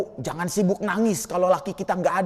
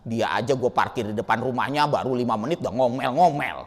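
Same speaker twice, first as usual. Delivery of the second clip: grudging complaint; 11 semitones lower, 4 dB quieter than usual. Retold or imitated. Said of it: "Kita mah nggak pernah ngingetin".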